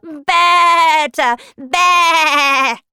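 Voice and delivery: loud, high, drawn-out wavering bleat; sheep-like bleating. Acted. Queen is changing into a sheep, words becoming bleats. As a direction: let each word turn further into a baa. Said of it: "sounded like the noise that a sheep makes".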